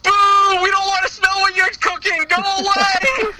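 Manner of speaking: high, playful jeering boos; mock booing kid. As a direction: heckle like a kid at the awards show. Mocking crowd booing The Rock.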